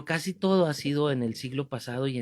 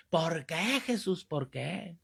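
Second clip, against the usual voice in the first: drawn-out mock wail, trailing softer; mock anguished outcry; 2 semitones higher, 5 dB quieter, slower than usual. Man at a retreat keeps interrupting, mock anguished outcry. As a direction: imitate a man lamenting to heaven. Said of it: "Y aquel".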